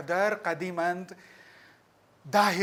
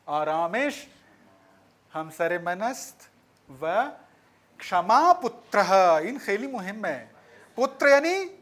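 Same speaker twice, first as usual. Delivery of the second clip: normal talking voice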